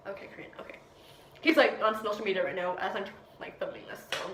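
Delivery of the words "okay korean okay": whispered aside. Real, not acted. Aside while filming.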